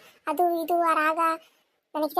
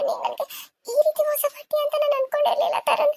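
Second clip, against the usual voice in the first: weep out the betrayal, voice breaking; crying; 9 semitones higher than usual. Acted. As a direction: heartbroken girlfriend sobbing at her lover. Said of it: "ಅಳ್ಬೇಡ ಗೀತಾ".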